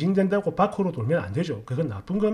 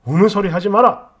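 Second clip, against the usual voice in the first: loud, raised-pitch reprimand; stern father's scolding; 4 semitones higher, 9 dB louder than usual. Retold or imitated. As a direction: scold like a father turning suddenly serious. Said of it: "딱 정색을 했어요".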